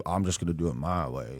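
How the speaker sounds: put-on low, soft grumpy old Southern man. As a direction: grumble it like a cranky old man. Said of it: "my, my old man voice".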